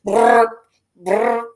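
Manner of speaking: high buzzing "brr" like a power tool; impact wrench noise. Retold or imitated. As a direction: mimic the impact gun spinning. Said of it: "I'm like".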